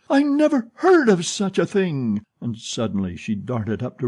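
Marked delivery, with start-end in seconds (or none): 0.1-2.2 high, loud indignant shout. Acted. Old woman shouts her outrage at being called thief. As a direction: shout it out, scandalised. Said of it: "She shouted".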